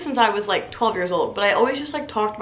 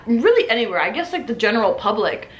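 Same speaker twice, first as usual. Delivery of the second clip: usual speaking voice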